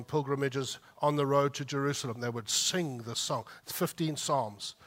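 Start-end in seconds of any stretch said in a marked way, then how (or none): none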